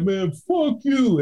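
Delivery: mock slow insult at the drunk guy; slow mocking curse. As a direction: sneer it out slowly. Insult exchange.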